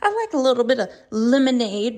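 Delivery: a sing-song polite cheer; mock chipper reply. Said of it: "you're like".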